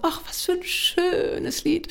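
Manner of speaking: high, drawn-out mock gushing; gushing relatives. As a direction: swoon over it, imitating delighted relatives. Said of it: "alle haben geschwärmt".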